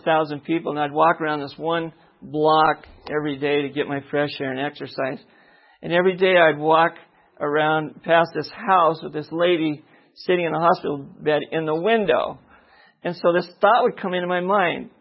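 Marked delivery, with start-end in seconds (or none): none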